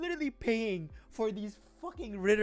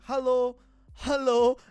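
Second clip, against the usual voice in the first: put-on German-accented hello, louder; mock German hello; 5 semitones higher, 10 dB louder than usual. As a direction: copy the German greeting. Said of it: "adapt their accent".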